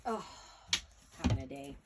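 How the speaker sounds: muttered off to the side; quiet aside. Real, not acted. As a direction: drop to a mumble while searching. Fumbling off mic.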